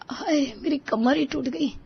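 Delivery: high, soft groan of back pain; tired daughter-in-law groaning after the walk.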